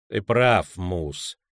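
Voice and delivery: chill him with calm; icy voice. Acted. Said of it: "ледяным голосом проговорил".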